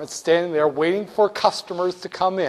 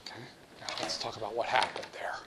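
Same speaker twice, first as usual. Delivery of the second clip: whisper; 7 semitones lower, 15 dB quieter, faster than usual. Real, not acted. Aside after skit.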